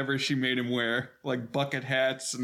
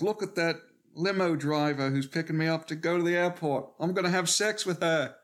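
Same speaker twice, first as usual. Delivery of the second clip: higher-pitched mock British accent; put-on British accent, pitched up; Russell Brand; 4 semitones higher than usual. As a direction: imitate a cocky British philanderer. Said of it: "even Russell Brand".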